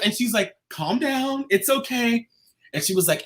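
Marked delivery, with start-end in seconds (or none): 0.7-2.3 softer, high-pitched imitation of someone calming her; soothing. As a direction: hush an overexcited arrival, higher and softer. Seller soothing her on arrival.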